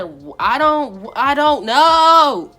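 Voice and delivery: loud, high, drawn-out wail; stammer, then stretch it into a cry; hammed-up helpless bawling; mock wail. Acted crying reply to officer.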